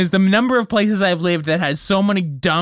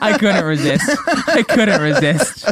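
laughing through the words; 4 semitones higher, 4 dB louder than usual. Real, not acted. After teasing his cohost, laughing through the words. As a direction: giggle it out, caught teasing.

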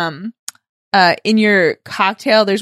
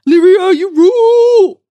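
loud, high, drawn-out cheering; stretched-out squealing shout of praise; fan cheer; 10 semitones higher, 6 dB louder than usual. Pretend. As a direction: holler adoringly to your hero. Cheering for the heroine.

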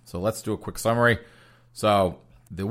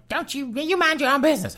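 squeaky high snapping voice; mock angry sister. Host voicing the angry sister telling her off, high-pitched. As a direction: snap it in a put-on high voice. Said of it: "'cause she's like".

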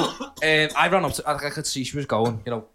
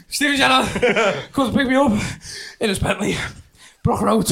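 excited boast; 8 semitones higher, 4 dB louder than usual. Retold or imitated. Retold boast to the girl.

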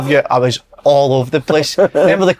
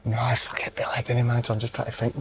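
much softer, half-whispered mutter; whispered aside; 4 semitones lower, 14 dB quieter than usual. Real, not acted. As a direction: whisper it to yourself while thinking. Muttering aside while thinking.

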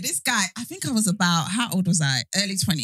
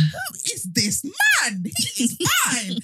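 high, drawn-out admiring exclamation; mock awestruck; 8 semitones higher, slower than usual. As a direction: swoon over him, stretching the words. Retelling being struck by handsome man.

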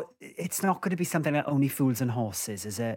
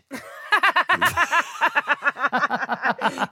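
helpless high-pitched giggling fit; sustained burst of real laughter; long high laugh; 12 semitones higher than usual. Real, not acted. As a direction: crack up at your own silly answer. Cracking up at own silly guess.

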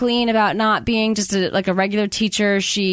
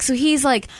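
usual speaking voice

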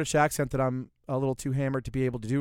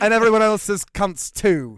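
high, loud, slow mock British accent through a laugh; laughing while hamming a British accent; 8 semitones higher, 10 dB louder, slower than usual. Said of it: "Can you do the accent for us?".

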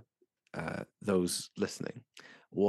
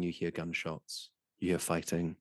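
normal talking voice

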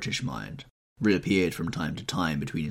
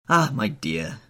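higher, louder society matron; Lady Narborough voice; 3 semitones higher, 4 dB louder, slower than usual. Acted. Old hostess fussing over Dorian, higher and louder.

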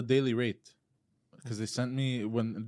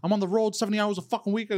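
higher, fast angry outburst; retold yelling; 9 semitones higher, 3 dB louder than usual. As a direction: fire it off at the collector. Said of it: "I started swearing at her".

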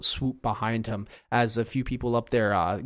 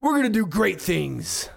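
higher, louder beaming hero promise; hero's mic voice; 7 semitones higher, 3 dB louder than usual. Acted. Hero back on the mic promising the crowd great things, beaming. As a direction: sell it to the crowd. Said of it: "puts it back and says".

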